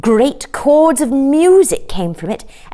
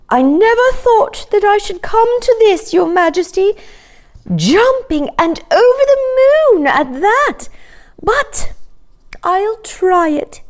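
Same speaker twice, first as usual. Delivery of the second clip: much higher, lighter voice for the cow; a shy cow answering royalty, high and light; red cow voice; 13 semitones higher, 3 dB louder than usual. A narrator's reading. Red cow to the king, agreeing shyly: high light voice.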